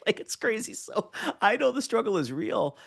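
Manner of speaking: high-pitched, talking through laughter; real amusement, pitched right up; laughing. Real, not acted. Laughs over own embarrassing story.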